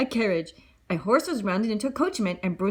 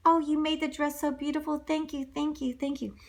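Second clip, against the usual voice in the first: put-on grateful girl; 6 semitones higher than usual. Cinderella thanking the animals.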